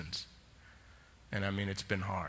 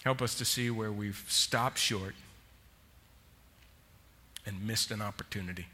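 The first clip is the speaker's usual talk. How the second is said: closing prayer. Real, not acted. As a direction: pray it gently over the congregation.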